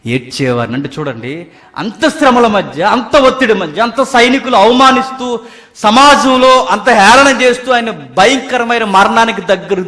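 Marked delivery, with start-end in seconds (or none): 1.8-9.9 building sermon climax. Acted.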